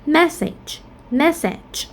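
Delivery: "message" stressed on MESS; high first syllable in "message", then fall; "message" as MESS-age, weak second vowel. Shown as a demonstration. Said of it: "primary stress on the first syllable".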